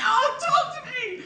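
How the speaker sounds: high, drawn-out mock plea; mock American audience. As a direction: imitate an over-eager audience calling out. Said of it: "But in an American accent".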